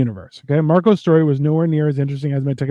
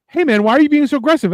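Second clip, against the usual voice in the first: high, hurried mock complaint; rushed, pitched-up imitation of protest; protesting cousin; 7 semitones higher, faster than usual. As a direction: imitate a timid objector, quick and high. Cousin's acted protest before being smacked.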